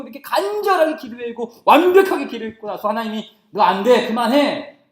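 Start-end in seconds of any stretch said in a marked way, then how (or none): 3.5-4.8 loud, abrupt no; firm refusal. Acted. God turning down Paul's prayer, firmly refusing. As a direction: shut the plea down loudly.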